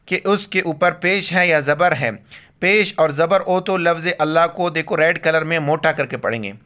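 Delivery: ordinary talk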